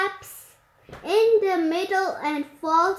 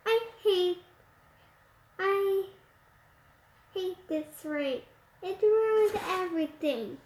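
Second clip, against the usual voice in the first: tearful; 6 dB quieter than usual. Acted. Ashlyn crying over the rain.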